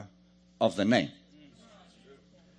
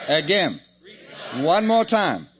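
loud call for a shout; rallying call; 3 semitones higher, 6 dB louder, slower than usual. Real, not acted. Preacher getting the congregation to repeat, rallying call. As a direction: urge the whole crowd to shout.

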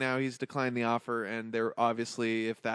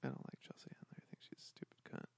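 hushed confession; whispering; 19 dB quieter than usual. Real, not acted. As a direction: whisper it, unvoiced.

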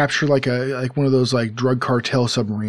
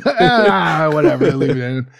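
laughing; 4 semitones higher, 3 dB louder, slower than usual. Real, not acted. Laughing off insensitive remark.

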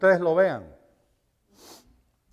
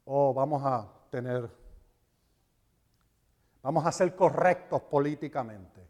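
mock wishy-washy; 5 semitones lower, 6 dB quieter than usual. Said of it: "lo que Jesús dijo".

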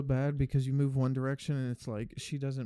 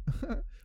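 high laugh; 11 semitones higher than usual. Real, not acted. Laughing at own joke.

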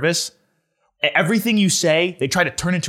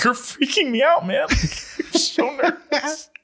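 high and giggling, slowing as the laugh takes over; laughing at the cop mid-test; 10 semitones higher, much slower than usual. Retold or imitated. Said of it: "I'm like".

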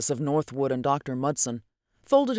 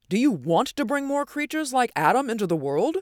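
high-pitched indignant question; flung challenge; 9 semitones higher than usual. A narrator's reading. Northwood challenges Doctor Mudson's plan, indignant. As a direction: fling it at him in protest. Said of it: "Northwood flung at him".

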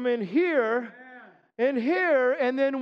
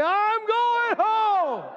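much higher, very slow held cry; preached refrain; 11 semitones higher, 4 dB louder, much slower than usual. Real, not acted. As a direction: lift it over the bad news. Refrain against bad news.

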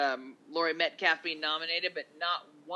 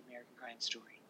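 usual speaking voice

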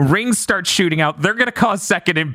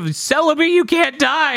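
mock pleading; 9 semitones higher than usual. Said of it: "the line of".

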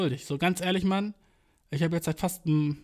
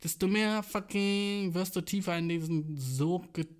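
ordinary talk, nothing marked

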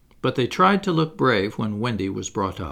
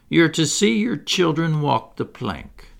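higher, syrupy pirate taunt; syrupy menace; 6 semitones higher, 3 dB louder than usual. A narrator's reading. Pirate captain taunting the boys' mother, syrupy. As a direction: sweetly relish the threat. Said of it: "as if he spoke in syrup".

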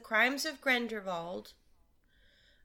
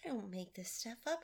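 exasperated whisper under breath; whispered aside; 2 semitones lower, 13 dB quieter than usual. Real, not acted. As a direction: mutter it to yourself, fed up. Exasperated host mutters aside.

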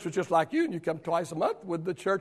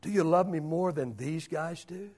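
much lower and softer, gentle questioning; quiet Jesus voice; 3 semitones lower, 8 dB quieter than usual. Acted. Jesus softly questioning Peter before the disciples, hushed. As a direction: ask Peter softly, searching him. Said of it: "pointed at the other disciples and said".

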